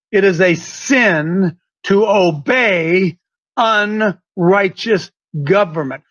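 slow, low and deliberate, word by word. Shown as a demonstration. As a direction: spell out "unrighteous government" slowly for emphasis. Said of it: "I'm going to say it again more slowly".

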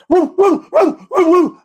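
high, loud yipping woo woo; mock dog barking. Mocking a congressman barking like a dog. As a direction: bark it out like a yapping dog. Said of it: "Trey Gowdy, where's he?".

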